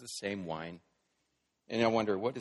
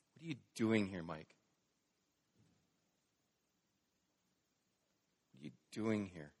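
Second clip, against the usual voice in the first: soft, low, searching question; hushed question; 2 semitones lower, 6 dB quieter than usual. Acted. Preacher putting God's question to himself, hushed. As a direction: let the question fall away quietly.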